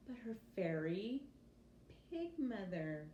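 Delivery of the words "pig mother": ordinary delivery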